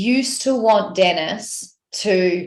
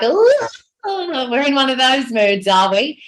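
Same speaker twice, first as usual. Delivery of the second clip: a light, higher mock-knowing tease; teasing; 5 semitones higher than usual. Husband teasing his grumpy wife, lightly mock-knowing. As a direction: play it as gentle ribbing. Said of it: "kind of tease me or be like".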